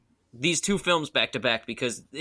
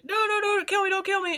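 much higher, hammed-up begging; mock pleading. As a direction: squeal for mercy like the victim. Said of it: "And he's like".

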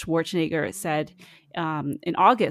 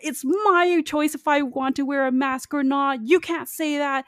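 much higher, whiny mock outrage at mask rules; mocking anti-masker; 9 semitones higher than usual. Mocking anti-maskers to co-hosts, high whiny outrage. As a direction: squeal it like an offended anti-masker. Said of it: "Lots of people are very like".

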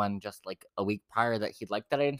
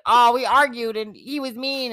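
high, loud, drawn-out complaint; hammed-up childish griping; mock whining; 12 semitones higher, 7 dB louder, slower than usual. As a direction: wail it like an overgrown tattletale. Mocks someone running off complaining.

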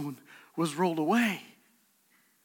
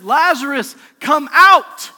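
commanding shout, much higher and louder; loud call; 6 semitones higher, 13 dB louder than usual. Acted. Jesus shouting to Lazarus at the tomb, full force. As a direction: call the dead man out of the tomb. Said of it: "Jesus called in a loud voice".

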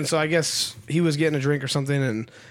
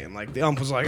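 ordinary delivery

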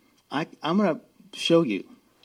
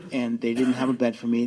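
ordinary talk

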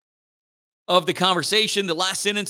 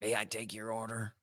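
much softer, stiff mock robot waiter; eighties robot voice; 6 semitones lower, 14 dB quieter than usual. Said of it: "talk like stereotypical eighties robots".

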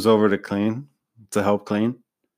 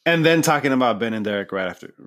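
ordinary delivery

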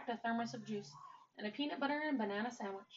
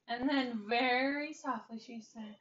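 plain reading voice

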